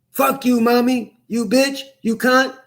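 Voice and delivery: mock a bratty child swearing; mocking bratty kid.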